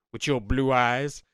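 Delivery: slow, loud prank-call voice; prank caller. Retold or imitated. Prank caller accusing a friend over a girl, slow loud menace. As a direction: stretch out the accusation, loud and put-on.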